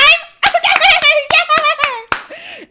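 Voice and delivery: very loud, high, sustained cackle; shrieking laugh. Real, not acted. Streamer cracking up about shower monologues, shrieking laugh. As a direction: burst into squealing laughter.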